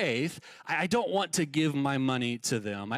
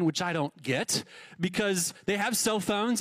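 ordinary talk

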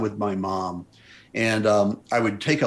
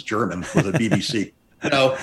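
normal talking voice

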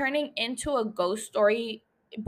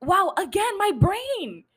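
normal talking voice

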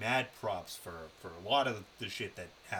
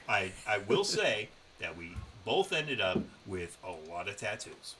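ordinary talk

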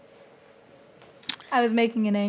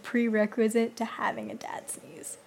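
whispering; 7 dB quieter than usual. Real, not acted. Trailing whispered musing.

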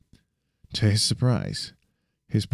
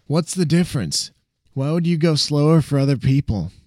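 put-on higher, amused young sidekick; cocky friend voice; 8 semitones higher, 4 dB louder than usual. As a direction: tease him, impressed but playful. Sam's voice, puzzled.

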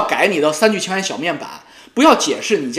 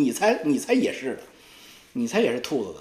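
usual speaking voice

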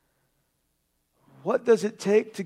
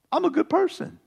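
higher, mocking repeat; mocking self-praise; 7 semitones higher, 4 dB quieter than usual. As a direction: toss it up high in mock pride. Repeating the quoted claim.

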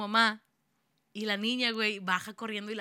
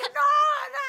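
much higher, louder, slow wailed no; crying shout; 9 dB louder than usual. Retold or imitated. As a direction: drag out the no as if sobbing. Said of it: "Estaba grite y grite de que no".

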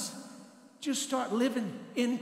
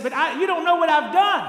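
loud, higher mock objection; mock protest; 5 semitones higher, 9 dB louder than usual. Preacher voicing a congregant's defensive excuse, loud mock protest. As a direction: shout the excuse like a defensive churchgoer. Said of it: "Somebody said".

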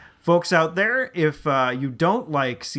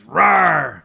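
loud imitated bear roar; 7 dB louder than usual. Shot bear turns on the hunter, loud roar. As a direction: roar like a bear turning on you. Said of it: "And the bear fucking turns around".

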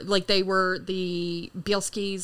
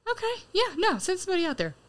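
high-pitched mock celebrity voice; imitating Liev; 8 semitones higher, faster than usual. Retold actor on the phone, high and chirpy. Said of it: "And he's like".

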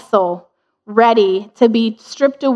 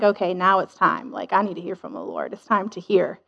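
hushed Elijah; 5 semitones lower, 10 dB quieter than usual. Retold or imitated. Elijah waiting to hear God.

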